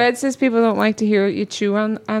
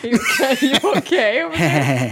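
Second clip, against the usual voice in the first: laughing. Real, not acted. Laughing at choking partner.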